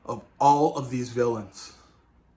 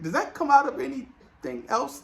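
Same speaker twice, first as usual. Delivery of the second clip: imitated question in a raised pitch; high-pitched mock curiosity; 11 semitones higher than usual. Spider-Man quizzing another about webs, in high mock curiosity. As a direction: ask it high, playing the curious one.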